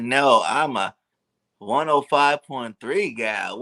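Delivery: usual speaking voice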